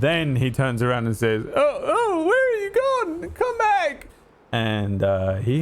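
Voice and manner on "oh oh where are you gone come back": very high, tearful wail calling after someone; panicked crying plea. Acted. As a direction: cry out like an abandoned soldier begging them to return. Soldier calls after fled friends.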